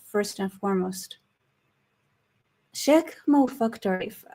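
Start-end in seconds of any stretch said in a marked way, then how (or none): 2.7-4.2 name said with an English accent. Real, not acted. Introducing the Druze leader by name, in an English accent. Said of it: "said it with an English accent".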